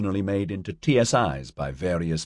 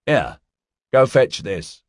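raised, higher-pitched call; 6 semitones higher, 3 dB louder, slower than usual. A narrator's reading. Marty sends Barry diving for a coin, calling out. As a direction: call a playful order to a kid.

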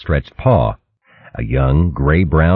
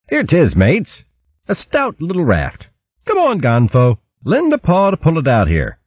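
raised, hearty matey tone; hearty otter voice; 11 semitones higher than usual. A narrator's reading. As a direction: bluff friendly queen.

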